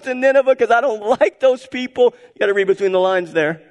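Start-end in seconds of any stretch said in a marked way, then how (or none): 0.0-2.1 higher, louder whiny prophet voice; sulky Jonah. Acted. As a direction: pout it out like a stubborn prophet who refuses to go. Preacher voices sulking Jonah.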